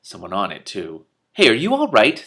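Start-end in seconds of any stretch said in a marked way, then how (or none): 1.3-2.2 louder and higher, urgent call; urgently. Acted. Rincewind to his friends in thin air, urgent. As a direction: shout over the wind to check on them. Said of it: "said Rincewind urgently".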